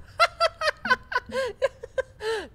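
very high, loud laughing shriek; high squeal of laughter. Real, not acted. Host laughs at his own joke about Guy getting girls, high squeal of laughter.

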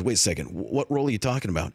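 deep, quick Chris Evans voice, puzzled; Chris Evans impression. Comedian imitating Chris Evans meeting him on set, low and quick. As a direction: drop low and rattle off a confused question. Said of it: "I do a decent Chris Evans".

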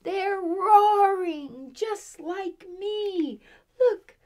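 excited storytelling. A narrator's reading. Reading to children at the climax, excited. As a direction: sell the big reveal to the kids.

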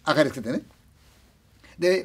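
usual speaking voice

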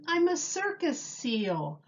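usual speaking voice

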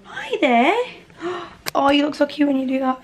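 high, cutesy greeting; cooing at a pet. Real, not acted. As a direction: coo at something adorable.

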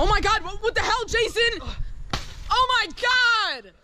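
high, loud outraged shrieks; outraged yelling. Real, not acted. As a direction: scream in disbelief at a friend. Friend grabs his dinner.

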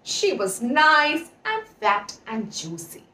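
ordinary delivery, nothing marked

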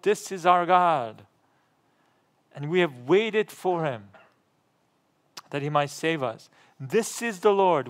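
preacher declaring scripture loudly; impassioned scripture reading. Acted. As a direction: proclaim the verse with rising force and joy. Reads scripture at full force.

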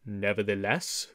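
low gruff growl. Acted. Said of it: "growled Little John".

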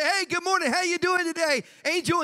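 high-pitched chirpy hello; cheery greeting. Acted. Coworker greeting someone walking in to work, high and cheery. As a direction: greet them brightly, pitched well up.

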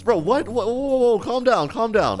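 high-pitched, playful alarm; mock alarm. Son to dad who's getting mad, mock alarm.

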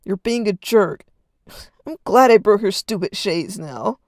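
loud, scornful shout; spiteful jab at his brother; scoffed. Acted. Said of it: "Arden scoffed".

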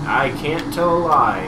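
slow, loud mock Lincoln line; Abraham Lincoln. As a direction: ham up a presidential declaration. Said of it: "as Abraham Lincoln".

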